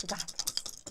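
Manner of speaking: whispering. Real, not acted. Repeated as a hushed pep talk.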